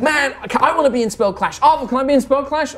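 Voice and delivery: put-on hyped-up teen begging to join in; high, eager pleading; Vala. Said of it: "super hyped up teenager".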